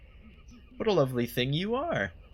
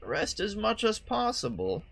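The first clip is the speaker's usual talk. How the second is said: put-on old lady voice; 7 semitones higher than usual. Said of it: "old lady voice for her".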